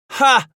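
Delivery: high, loud crazed bark of laughter; a single wild laugh burst; mad laughing bellow. Acted. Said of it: "he bellowed with mad laughter".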